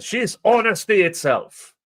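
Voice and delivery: high, gushing fake praise; mock gushing praise. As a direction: gush it like an admirer. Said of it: "people who said".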